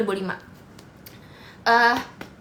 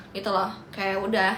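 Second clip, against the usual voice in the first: ordinary delivery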